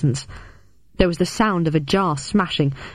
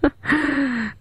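short laugh in a put-on voice; janitor's laugh; 8 semitones higher than usual. A narrator's reading. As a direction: chuckle as the old janitor. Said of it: "The janitor laughed".

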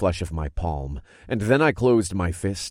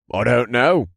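high dismissive other man; shrugging; 5 semitones higher, 6 dB louder than usual. A narrator's reading. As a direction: toss it off with a shrug. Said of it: "The other elder shrugged".

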